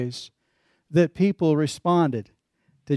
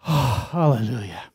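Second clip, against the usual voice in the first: lowered, sighed-out relief; relieved sigh; 4 semitones lower than usual. Acted. Rider saved at the edge of the drop-off, relieved sigh. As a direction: let it out on a long relieved breath.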